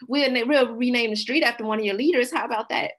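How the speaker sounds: high, loud mock-generous offer; mock placating. Officials offering residents a renamed street, mock placating. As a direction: pitch it up like a sweetener for a raw deal.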